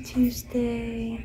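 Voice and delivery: soft whispered name; whispered goodbye to the turtle. Real, not acted. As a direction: breathe the pet's name tenderly. Tender name to pet.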